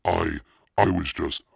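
high, loud, flustered stammer; halting, flustered self-defence; choked-out stammer. A narrator's reading. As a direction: falter on the first word, alarmed. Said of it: "she managed to choke out".

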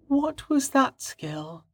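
high, soft, slow female voice; woman's voice. Acted. Maharia asks Rain a question.

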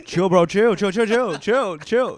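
high, loud chill bro echo; hammed-up bro pleading chill chill; frat bro. Echoing Bishop's bro voice.

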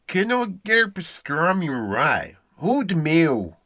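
mangled Southern US accent, straying far off; bad Texas accent. Shown as a demonstration. Coach demonstrates the South for pupil, botched Texas accent. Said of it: "Let's try something from the South".

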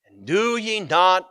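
slow, deliberate, weighty reading of the verse; preacher reading the rebuke to the disciples; solemn scripture reading. Acted. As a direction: let each phrase land slowly.

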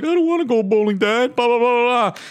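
put-on high, whining kid complaining; whiny kid voice. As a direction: whine it like a bored child. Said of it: "at first they were like".

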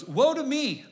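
high, loud anguished cry of woe; Isaiah crying out in anguish. Acted. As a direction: wail it out in despair. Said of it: "I cried".

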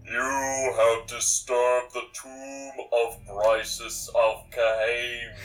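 slow, booming tomb voice; dopey cartoon bellow. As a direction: boom it out like a tomb guardian. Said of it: "you hear a booming voice".

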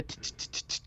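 slow ticking tongue-click sprinkler sound; sprinkler noise. Retold or imitated. Imitating a ticking lawn sprinkler.

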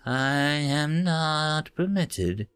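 very slow flat "permitted"; robotic, each word drawn out; monotone translator. Acted. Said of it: "in a monotone voice".

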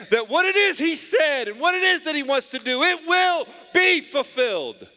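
high, impassioned sermon climax; full-force preaching. Real, not acted. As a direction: proclaim it to the congregation with rising force.

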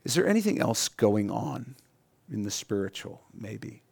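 soft, gentle nudge; gentle Holy Spirit voice. Retold or imitated. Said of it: "the Holy Spirit just says".

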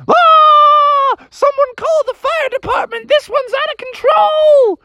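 very high screaming frog; hysterical Froggy. Acted. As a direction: open on a loud shriek, then squeal for help. Frog screams as pythons multiply.

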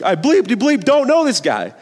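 angry cursing. Acted. Peter cursing at the accuser in a mock angry outburst. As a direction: spit out the bleeped curses in a hammed-up rage. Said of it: "he started cursing".

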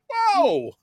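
high squeaky whoa of wonder; hammed-up kid's whoa; mock amazed whoa. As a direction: gasp it out like a starstruck kid. Kid's reaction voiced in recap.

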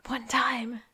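voiceless whisper, two words; whispered plea. Retold or imitated. Sean whispering a plea before throwing the phone. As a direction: breathe it out like a secret prayer.

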